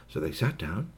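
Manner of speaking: ordinary delivery, nothing marked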